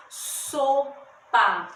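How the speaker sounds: SO, pause, PA, each syllable separate; split into syllables. Shown as a demonstration. Teacher showing two syllables in sopa, syllable by syllable. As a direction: sound out sopa in two drawn-out beats. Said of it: "eu abro minha boca duas vezes pra pronunciar".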